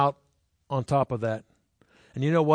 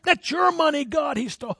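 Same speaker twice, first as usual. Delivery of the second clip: higher, hammed-up indignant plea to God; mock huffing outrage; 11 semitones higher than usual. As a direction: play the huffy believer demanding payback. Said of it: "all huffing".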